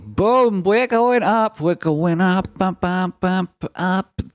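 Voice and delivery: high, loud celebrating; thrilled shout as the chart climbs; excited cheering. Real, not acted. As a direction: cheer the price going up. Celebrating rising numbers.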